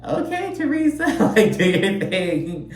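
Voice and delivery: high, laughing mock cheer; lightly mocking high cheer; amused cheer. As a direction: squeal it out, amused and teasing.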